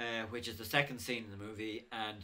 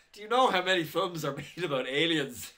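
louder, higher mock-incredulous question; retold exasperated disbelief; retold incredulity; 6 semitones higher, 8 dB louder than usual. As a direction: imitate a big guy's baffled challenge. Retold baffled man challenging filmmaker.

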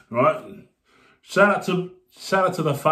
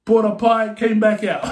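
normal talking voice

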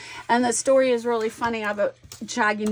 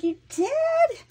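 high-pitched, delighted question; excited. Retold or imitated. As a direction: re-enact excited disbelief, pitch leaping up. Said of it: "I was all excited".

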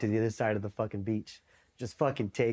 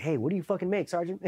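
high-pitched cheeky inquiry; retold eager question; 7 semitones higher than usual. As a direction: act out pouncing on someone with a question. He quizzes sergeant about BAH.

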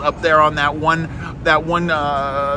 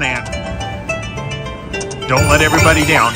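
ordinary delivery

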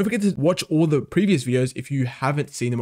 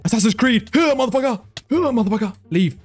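high-pitched yelps while striking; excited battle cries; 8 semitones higher than usual. Real, not acted. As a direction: call out wildly with each hit. Shouts while attacking in game.

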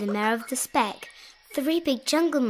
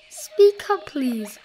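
higher, louder request to a faint voice; calling out; 5 semitones higher, 5 dB louder, slower than usual. A narrator's reading. Elephant asking the faint speck voice, calling out. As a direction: raise your voice to coax out an answer.

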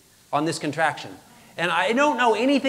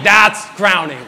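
loud, slow repeat of the shock; alarmed realization; 9 dB louder, slower than usual. Retold or imitated. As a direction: stretch it out in comic alarm.

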